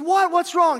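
high, startled what's wrong; confused, voice jumping up; baffled husband. Retold or imitated.

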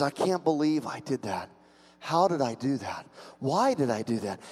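softly spoken disbelief at oneself; mild, acted remorse; guilty self-reproach. Voicing Peter's guilt.

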